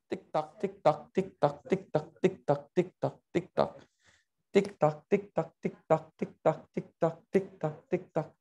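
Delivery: soft, evenly spaced tick-tock chant; imitated ticking clock. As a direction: count down the seconds like a clock. Imitating a clock during the search.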